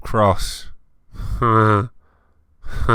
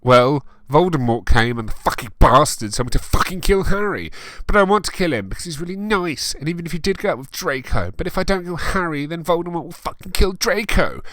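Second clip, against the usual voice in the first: mock tearful rant; 9 semitones higher, 4 dB louder than usual. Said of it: "I suddenly burst into tears".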